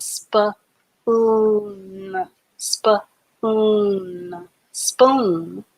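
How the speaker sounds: "spoon" blended slowly from its sounds; blending spoon. Shown as a demonstration. Teacher blending the word spoon for the class. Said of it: "And then N has the N sound".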